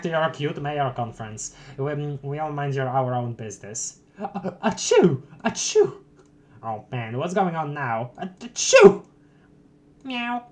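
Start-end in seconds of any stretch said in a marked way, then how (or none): 4.2-5.9 fake sneeze. Acted character sneezes. 8.2-9.0 fake sneeze. Acted sneeze. 10.0-10.4 cat meow. Pretend.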